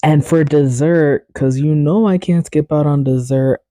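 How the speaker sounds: usual speaking voice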